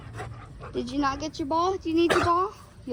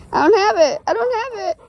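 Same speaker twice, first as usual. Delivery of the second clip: loud laughing tease; playful laughing denial to the dog; 7 semitones higher, 10 dB louder than usual. Real, not acted. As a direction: giggle it out, higher and louder.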